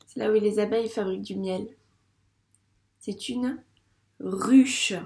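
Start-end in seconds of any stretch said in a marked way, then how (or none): 4.2-4.9 "ruche" said loud with a sounded final e; teacher's careful, emphatic "ruche"; word sounded out. Shown as a demonstration. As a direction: sound out "ruche" clearly for a young pupil.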